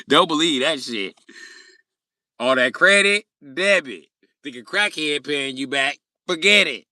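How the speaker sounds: loud rant. Real, not acted. Streamer ranting to chat about credit. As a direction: hammer each line at chat.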